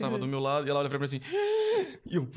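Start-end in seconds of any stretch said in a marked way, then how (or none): 1.2-2.0 sharp gasp. Retold or imitated. Shocked gasp in retold scene.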